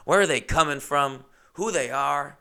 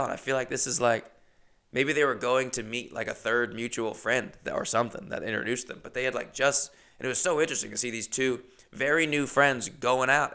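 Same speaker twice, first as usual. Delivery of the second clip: ordinary talk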